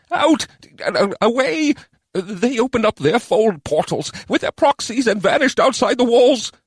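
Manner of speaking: high, squeaky, hesitant goblin; goblin adviser. A narrator's reading. Goblin explaining where the elves went, squeaky.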